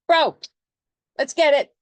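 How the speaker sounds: loud, high hype-up shout; punchy, shouted pep talk; pushy Aries. Acted. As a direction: egg a friend on at full volume. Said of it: "And Aries is like".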